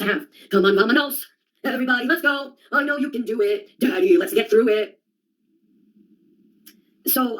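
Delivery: ordinary talk, nothing marked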